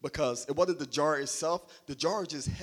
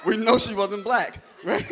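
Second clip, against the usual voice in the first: higher, much louder, breaking into laughter; laughing punchline; 6 semitones higher, 10 dB louder than usual. Real, not acted. Preacher repeats his joke to the congregation, laughing loudly. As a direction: hammer the joke home, laughing.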